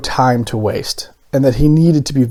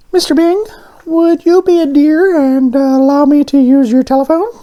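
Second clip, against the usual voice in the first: polite donut; 14 semitones higher, 5 dB louder than usual. A narrator's reading. Arnie asks for the phone, high and polite. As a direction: ask it oh so sweetly. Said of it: "Arnie asked politely".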